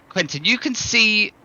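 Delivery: normal talking voice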